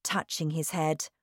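ordinary delivery, nothing marked

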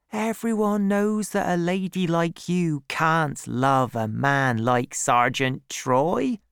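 lightly voiced maid, starting high; reassuring servant Liddy. A narrator's reading. Maid reassuring her mistress about Troy, lightly in character. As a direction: reassure as a maid, slowing toward the end.

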